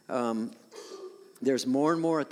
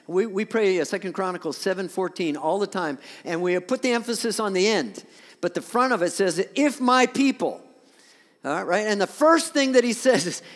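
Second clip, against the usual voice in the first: louder, higher preaching from the pulpit; full-force preaching; 7 semitones higher, 9 dB louder than usual. Acted. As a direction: preach it out to the whole church.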